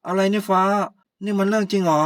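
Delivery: husband confronts his wife, stunned and loud; shocked outburst. Acted. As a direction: react as if you can't believe it.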